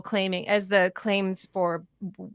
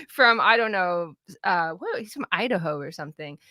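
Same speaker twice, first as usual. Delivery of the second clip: normal talking voice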